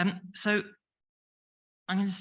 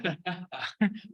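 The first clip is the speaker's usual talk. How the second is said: bursting into a higher laugh; amused laugh after an audience joke; real laugh; 4 semitones higher than usual. Real, not acted.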